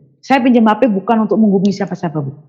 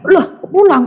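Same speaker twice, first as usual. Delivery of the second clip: shocked question; 11 semitones higher, 4 dB louder than usual. Retold or imitated.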